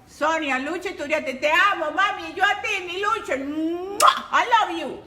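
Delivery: ordinary delivery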